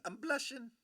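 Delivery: high, coy blushing voice; bashful girl voice. Retold or imitated. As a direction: act shy and flattered. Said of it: "Lisa's like".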